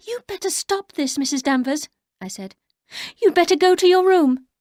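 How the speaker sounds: high, strained command; nervous order. Acted. Narrator ordering Danvers to her room, high and strained.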